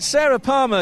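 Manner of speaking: ordinary delivery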